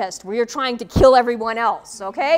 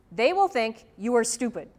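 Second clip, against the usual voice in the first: ordinary talk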